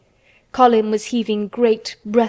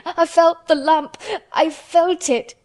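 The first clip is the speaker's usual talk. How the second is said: high, slow tearful wail; choked out; 7 semitones higher, 4 dB louder, slower than usual. A narrator's reading. Panicking boy protests to the girl, choking. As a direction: drag the words out through choking sobs. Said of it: "choked out Colin".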